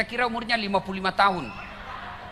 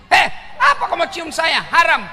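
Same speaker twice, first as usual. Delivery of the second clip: sharp, higher, louder rebuke; hammed-up outraged cleric; indignant shout; 10 semitones higher, 6 dB louder than usual. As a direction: scold her off, half joking. Ustaz rebukes kissing woman.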